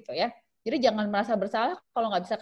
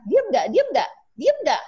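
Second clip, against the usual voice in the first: higher, louder barked demand, each one sharper; angry yell; 8 semitones higher, 6 dB louder than usual. Shown as a demonstration. Frustrated mother shouting at her tantruming child, loud angry yell.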